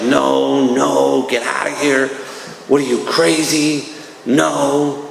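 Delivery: acting out a dismissive, drawn-out no; incredulous refusal. Speaker replaying his refusal of a friend's way out, incredulous.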